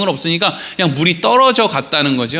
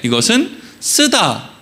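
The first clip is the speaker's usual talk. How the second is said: normal talking voice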